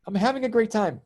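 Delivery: retold cheerful protest. Retelling a spat with mother-in-law, cheerful protest. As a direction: insist brightly, as if arguing back.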